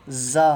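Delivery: زا with a long buzzing z. Shown as a demonstration. Teacher shows a buzzing زا to students. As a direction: let the z of زا buzz. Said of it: "جھنجھناہٹ جیسا آواز".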